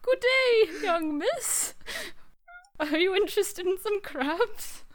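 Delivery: high, loud, British-sounding Japanese lady; high, singsong Japanese lady accent gone British; attempted Japanese accent. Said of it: "Japanese accent".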